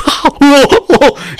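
giggling high-pitched laugh; high helpless laugh. Real, not acted. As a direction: burst into laughter. Laughing fit.

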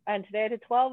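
normal talking voice

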